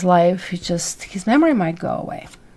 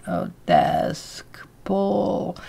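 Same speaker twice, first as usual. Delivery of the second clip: whispered, groping for the words; hesitant whisper; 5 dB quieter, much slower than usual. Retold or imitated. Daughter imitating her father at the doctor's, unsure whisper. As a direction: breathe the words out like a forgetful old man straining to recall. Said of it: "he couldn't remember the third one".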